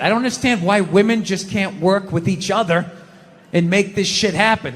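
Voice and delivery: ordinary delivery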